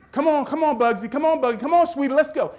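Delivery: very high, loud imitation of mom; mother's far-carrying cheer. Mother cheering her son from the end zone, imitated holler. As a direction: holler pet names so they carry across a stadium. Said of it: "you could hear her if you was over in Kansas".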